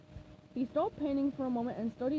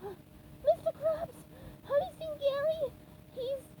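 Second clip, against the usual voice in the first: much higher, slow, panting; out of breath; 14 semitones higher, slower than usual. Acted. SpongeBob bursting into Krabs's office, out of breath. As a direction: gasp out the question breathlessly. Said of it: "ran in out of breath".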